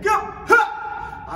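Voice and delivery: high, loud karate yell; kiai shout. Shown as a demonstration. Karate teacher shouting the kick call for students, loud. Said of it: "avec le kick".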